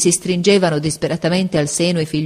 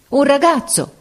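much higher, louder call; sailors shout; 9 semitones higher, 3 dB louder than usual. A narrator's reading. Sailors call for a boy, shouting. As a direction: fling the call out. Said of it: "gridarono i marinai".

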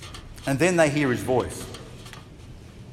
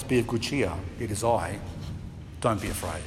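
tender reassurance; 6 semitones lower, 6 dB quieter than usual. Acted. Jesus to the listener in fear, softer tender reassurance. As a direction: lower and softer, gentle promise. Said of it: "Jesus always tells us".

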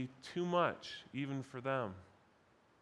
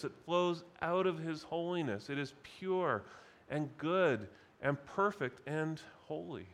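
normal talking voice

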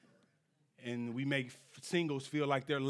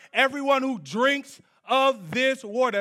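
loud, raised preaching delivery; scripture proclamation; 7 semitones higher, 9 dB louder than usual. Real, not acted. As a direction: declaim the verse to the congregation. Declaims scripture.

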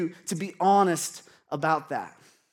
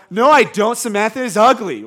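very loud, high schoolkid denial; defensive junior higher; 4 semitones higher, 13 dB louder than usual. Acted. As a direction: overblown denial of a crush, almost shouted. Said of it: "kinda sounds like when a junior hire is accused".

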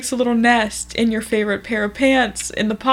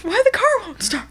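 higher, bewildered lament; Marie; 12 semitones higher than usual. Acted. Doing Marie from the show, higher and baffled. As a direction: ask it like a baffled whiner.

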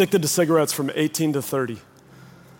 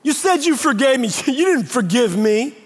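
mock-wounded whining, pitched high; whiny accuser; 7 semitones higher, 3 dB louder than usual. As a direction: mimic a hurt friend complaining. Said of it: "They'll literally say".

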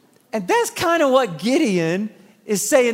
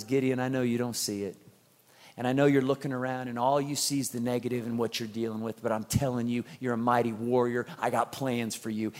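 God's reassurance; 10 semitones lower, 10 dB quieter than usual. Acted. God's voice in retelling.

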